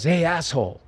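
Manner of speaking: mildly indignant quoted challenge; retold telling-off. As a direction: call him out, without raising the volume. Retold telling-off of negligent boyfriend.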